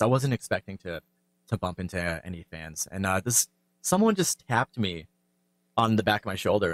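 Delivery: usual speaking voice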